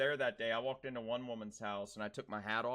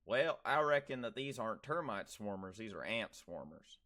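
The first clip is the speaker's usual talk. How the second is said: put-on country Southern accent, pitched up at the start; thick Southern accent; 3 semitones lower than usual. Shown as a demonstration. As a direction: talk like a Southerner from out in the sticks. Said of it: "someone out in the sticks".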